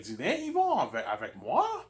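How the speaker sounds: high-pitched imitation of her startled question; surprised question. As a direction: echo it back high, rising at the end. Retells her startled echo question.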